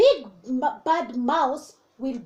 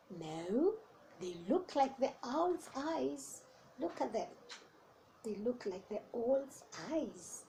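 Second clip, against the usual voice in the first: quiet aside; 12 dB quieter than usual. Real, not acted. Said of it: "quiet aside to listeners".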